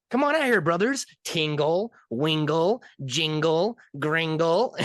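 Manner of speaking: high elf calling his brothers. Retold or imitated. Elf calling his brothers out, high and squeaky. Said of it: "he's like".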